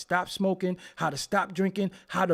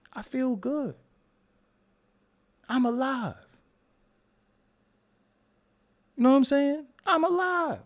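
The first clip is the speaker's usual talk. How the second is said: higher, lilting declaration of feeling good; chirpy; 6 semitones higher than usual. Real, not acted. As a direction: sing out that you're alive. Cheerful insistence.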